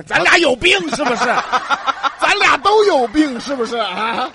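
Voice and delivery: loud laughing disbelief. Real, not acted. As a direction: laugh through the words. Both cracking up at silly joke.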